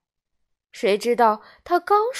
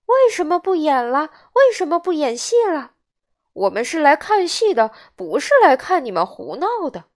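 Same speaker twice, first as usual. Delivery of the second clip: higher-pitched indignant protest from the crowd; raised, complaining crowd voice; clamouring audience; 5 semitones higher, 3 dB louder than usual. A narrator's reading. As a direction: call out like annoyed theatre-goers. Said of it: "剧场里就轰轰地闹了起来".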